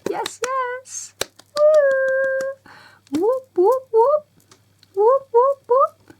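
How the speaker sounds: high-pitched, loud excited cheers; celebratory whooping. Real, not acted. Learner celebrating booked driving lessons, high excited whooping. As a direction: whoop it up in real delight.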